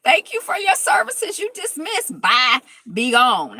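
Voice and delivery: high and loud triumphant send-off; gleeful dismissal. Real, not acted. Host gleefully echoing Shawnee's dismissal, high and loud. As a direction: belt it out like cheering a win. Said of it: "I was like".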